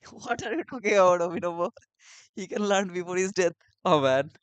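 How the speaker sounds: loud, laughing outburst of disbelief. Real, not acted. Friend laughing loudly at a joke about learning before death. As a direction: laugh it out, can't believe he said that.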